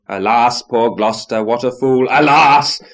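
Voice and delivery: loud, rising lament of alas; a dramatic loud exclamation; exclaiming. A narrator's reading. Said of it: "the mastodons exclaimed".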